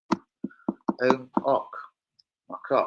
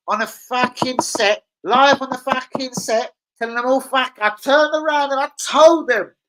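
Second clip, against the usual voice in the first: high, drawn-out exclaiming, slowly building louder; shouted retelling; 12 semitones higher, 6 dB louder than usual. Retold or imitated. Telling viewers how Peggy reappeared, shouted retelling. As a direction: stretch it out high and end on a shout. Excited retelling of soap scene.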